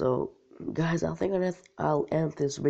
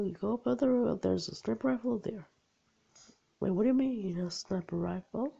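ordinary delivery

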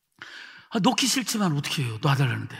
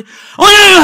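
high, shouted imitation of tongues; forceful prayer; 12 semitones higher, 18 dB louder, slower than usual. Boy praying too hard in tongues, forceful mock shout. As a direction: hurl the babble out at full force. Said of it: "기도를 세게 해서".

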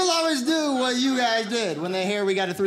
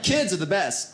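ordinary talk

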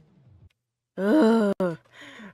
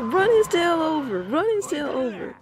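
high triumphant cheers; excited cheering; 9 semitones higher, slower than usual. Real, not acted. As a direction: cheer on the win. Cheering during game fight.

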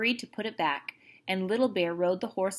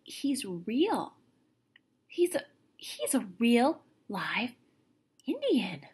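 stunned croak sinking to a whisper; croaking half-whisper of disbelief; 4 semitones higher, slower than usual. Acted. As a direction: barely get the words out, awestruck. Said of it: "and croaked out".

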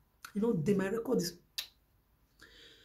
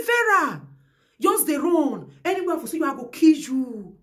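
pitched up and loud, taunting threat; high loud mocking threat; 5 semitones higher, 6 dB louder than usual. Mimicking the enemy's threat to Vera, high and loud. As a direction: shout their threat back mockingly. Quoting threat against her.

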